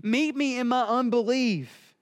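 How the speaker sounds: high-pitched, earnest acted plea; pleading father. Father pleads with Jesus for help in his unbelief, pleading. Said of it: "here this father is saying".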